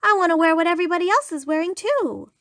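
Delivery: high, rushed, eager iguana; excited cry. A narrator's reading. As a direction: rush it out, high and worked up. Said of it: "she cried".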